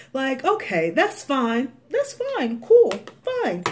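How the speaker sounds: high mock-accepting "that's fine"; sarcastic high-pitched shrug. Sarcastic brush-off of "full-time job.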